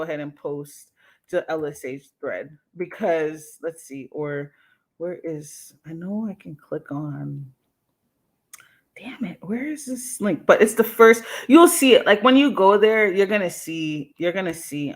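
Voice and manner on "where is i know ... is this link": frustrated whisper. Real, not acted. Searching for the link live.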